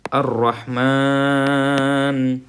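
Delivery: slow, drawn-out tajweed recitation; Quranic recitation. Shown as a demonstration. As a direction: chant each word slowly. Slow recitation before meaning. (ٹھہر ٹھہر کر تلاوت)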